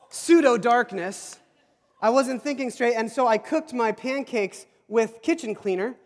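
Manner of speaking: usual speaking voice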